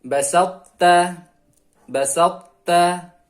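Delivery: "basakta" slow and loud, heavy t held into light ta; heavy unechoed tha. Shown as a demonstration. Teacher models tha meeting ta, loud slow recitation without echo. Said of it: "Jadi huruf qalqalah ta hilang".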